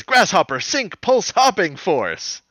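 high, slow hero call. Retold or imitated. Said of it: "Enzo says".

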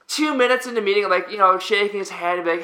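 normal talking voice

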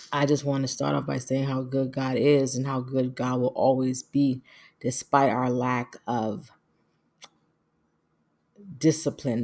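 normal talking voice

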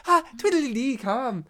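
mock Irish accent, joking and bouncy; a playful fake Irish accent for the actor; bad Irish accent. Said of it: "That was my Irish accent".

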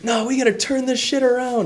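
high put-on angry voice; imitated ranting caller. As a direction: rant as the fired-up caller. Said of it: "he basically was screaming at me".

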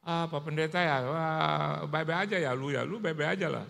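tiruan ringan sapaan akrab teman Chinese. Retold or imitated. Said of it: "saya juga harus begitu". (sapaan akrab ala teman Tionghoa)

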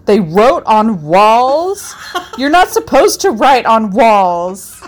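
put-on slow, loud little kid outrage; shocked child. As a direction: gasp it like a scandalised kid. Quoting the shocked five-year-old.

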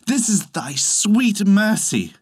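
high, slow wondering prayer; awed thanks. A narrator's reading. Peasant thanks goddess for the baby.

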